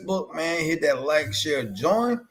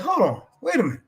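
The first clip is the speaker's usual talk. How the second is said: retold incredulous challenge; higher voice, rising to louder mock confrontation; Triple H; 8 semitones higher, 4 dB louder, faster than usual. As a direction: imitate someone calling out a fake. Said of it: "Triple H was like".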